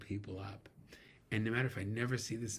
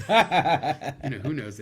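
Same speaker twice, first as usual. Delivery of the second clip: laughing; 7 semitones higher, 16 dB louder, much slower than usual. Real, not acted. Laughing at his Roots joke.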